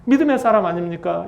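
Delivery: ordinary delivery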